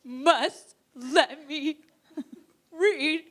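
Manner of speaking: high, loud, very drawn-out laughing plea; dramatic plea. Retold or imitated. Begging her girlfriend to let her read it, high and drawn out. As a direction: stretch it out, begging grandly.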